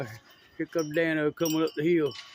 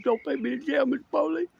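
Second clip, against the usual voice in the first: a hint of a higher pleading voice; mock begging suspect; 7 semitones higher, faster than usual. Retelling the suspect's words to viewers: lightly mock whine. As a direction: lightly whine it like the scared man. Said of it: "I swear I think he said".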